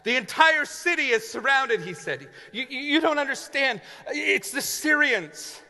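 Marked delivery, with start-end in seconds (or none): 0.0-1.8 high, loud urgent shout. Acted. Servant shouting the news to the prophet, panicked. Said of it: "he shouted out the details". 2.5-5.6 high, nervous laughing stammer; panicked stammer. Acted. Servant stammering in panic to the prophet.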